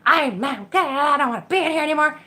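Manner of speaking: huffy refusal. Retold or imitated. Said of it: "And so I was like".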